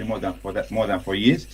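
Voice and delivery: ordinary talk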